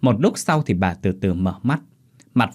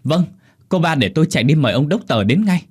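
raised, hurried-sounding servant reply; urgent compliance; 6 semitones higher, 5 dB louder than usual. Acted. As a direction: answer a frantic order at once. Servant answers the frantic daughter urgently. (vâng dạ gấp gáp, giọng cao)

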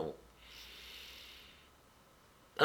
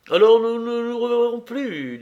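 raised, louder character voice; guest taking leave; 10 semitones higher, 7 dB louder than usual. A narrator's reading. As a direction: call the parting question out, pitched high. Narrator voices Yavchine taking leave. (invité qui prend congé, voix haute et forte)

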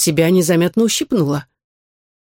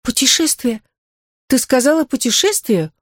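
high surprised questions; 7 semitones higher than usual. Acted. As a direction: ask it in amazement. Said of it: "удивилась Нина".

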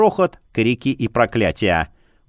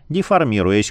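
plain reading voice

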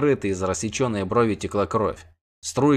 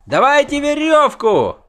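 very high, drawn-out loud yell; 6 dB louder than usual. A narrator's reading. Bard demands a rope for his friends, yelling. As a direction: bellow slowly for help. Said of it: "орал Лютик".

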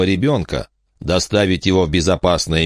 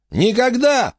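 yell; slower than usual. Acted. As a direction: bellow it to tease them. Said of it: "проорал Енах прямо в телефон".

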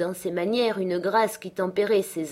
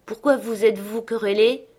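higher, louder puzzled question; disconcerted; 4 semitones higher, 5 dB louder than usual. A narrator's reading. Catherine learning of the old quarrel, disconcerted. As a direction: ask it taken aback. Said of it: "demanda Catherine très décontenancée".

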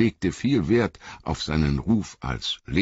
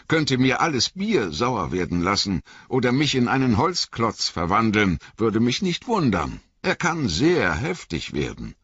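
lightly voiced garrulous innkeeper, rambling; a talkative landlord spinning his tale; chatty innkeeper; 6 semitones higher than usual. A narrator's reading. Innkeeper rambling on to Frodo, lightly voiced. Innkeeper explains about Gandalf.

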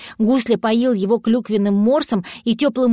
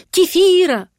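loud cry; 9 semitones higher, 5 dB louder than usual. Retold or imitated. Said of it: "говорит мне".

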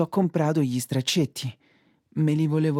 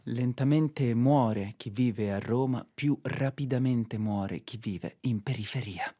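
mock poem recital; 2 semitones lower, 3 dB quieter than usual. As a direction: recite like a poet, deadpan. Poem-like monologue, lower, softer.

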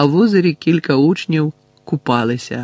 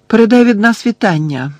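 high, raised-voice call; 8 semitones higher, 6 dB louder than usual. A narrator's reading. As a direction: call after someone who is leaving. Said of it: "крикнула Герміона".

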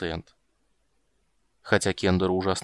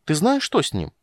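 impatient; 7 semitones higher, 5 dB louder than usual. A narrator's reading. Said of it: "не выдержал Тасс".

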